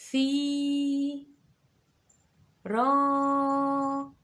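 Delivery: ثي، را held long and loud; teacher stretching the vowels; drawn-out syllables. Shown as a demonstration. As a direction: lengthen each long vowel in ثي and را. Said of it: "طولت هنا".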